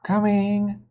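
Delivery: high, loud reply; shouting back. A narrator's reading. Annie shouting back to Mom, high and loud. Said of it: "Annie shouted again".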